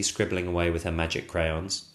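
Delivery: ordinary delivery, nothing marked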